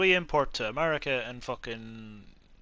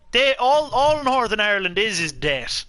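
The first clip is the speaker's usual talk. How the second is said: loud, high, heated insistence; emphatic outburst; 10 semitones higher, 9 dB louder than usual. Real, not acted. Irish friend arguing Northern Ireland is debt, loud and emphatic. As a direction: raise your voice to drive the point home.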